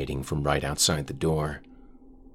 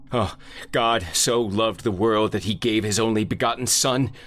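higher, louder, desperate scripture; frantic reading; 7 semitones higher, 3 dB louder than usual. Acted. Narrator reading the Bible aloud against the shadow, frantic and pitched up. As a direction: read the verse like it's your only hope.

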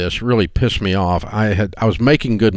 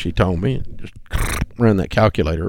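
usual speaking voice

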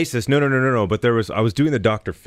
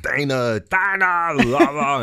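louder, rising repeated word; obsessive impression practice; 9 semitones higher, 6 dB louder, slower than usual. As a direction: drill the word louder each time, in character. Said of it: "It was literally just like".

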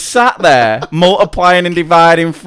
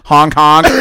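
put-on little boy's honk. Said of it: "squeezed my boob and said".

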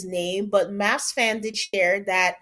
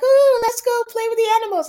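excited; 12 semitones higher, 7 dB louder than usual. Retold or imitated. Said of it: "Becca was like".

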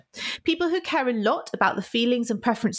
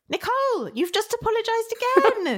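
exasperated; 14 semitones higher than usual. Acted. Scripted skit, mock exasperated scold.

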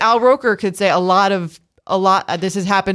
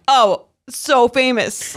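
mock realization; 6 semitones higher, much slower than usual. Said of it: "now I'm like".

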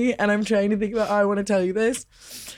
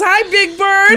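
teasing greeting; 10 semitones higher, 7 dB louder, slower than usual. Retold or imitated. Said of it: "he goes".

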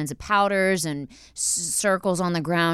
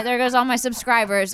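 ordinary talk

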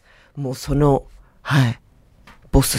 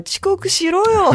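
mock grumble; much slower than usual. Mock voicing of Otake's grumble.

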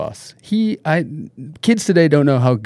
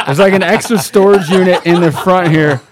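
laughing joke; 6 semitones higher, 6 dB louder than usual. Real, not acted. Joking while laughing.

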